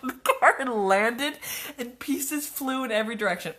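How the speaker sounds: reading through real laughter; can't keep a straight face reading it; laughing. Real, not acted. Rereading her story, cracking up.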